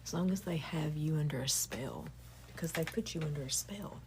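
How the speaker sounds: hushed, breathy whisper that sinks under the words; whispered teaching. Acted. Telling listeners quietly how systems keep them ashamed, whispered. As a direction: lean in and whisper it like a secret. Whispered talk about control.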